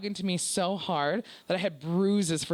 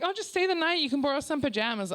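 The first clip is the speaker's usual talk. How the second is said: high-pitched, carefree imitation; breezy friend impression; 7 semitones higher than usual. Friend offering pajamas for a sleepover, breezy. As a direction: offer it lightly in a higher voice.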